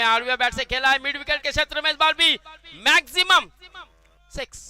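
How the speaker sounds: loud, high, fast commentary; excited six call. Real, not acted. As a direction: build up to the maximum. Another six called.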